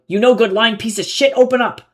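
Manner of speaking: high, loud furious yelling; angry yell. A narrator's reading. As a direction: curse at the man behind the door. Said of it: "he yelled inside".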